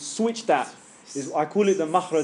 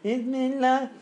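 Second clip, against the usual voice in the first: high, squeaky, tense "Bismillah"; squeaky imitation; 9 semitones higher, slower than usual. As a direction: mimic a stressed student squeaking it out. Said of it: "you get squeaky".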